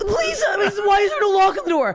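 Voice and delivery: high frightened cry; squeaky scared pleading, half shouted; panicked plea. Retold or imitated. Comedian replaying her scared plea to the old creep. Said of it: "I'm like".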